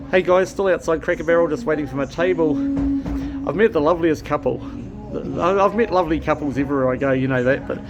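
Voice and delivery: loud, bright hello-to-camera energy; chirpy travel vlogger greeting; upbeat vlog to camera. Real, not acted. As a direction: sell the moment to your viewers.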